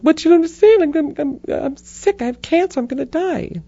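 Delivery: very high, pleading mock wail; hammed-up, high-pitched desperation; mock panicked protest. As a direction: protest like a melodramatic victim. Protesting to the voice in her head.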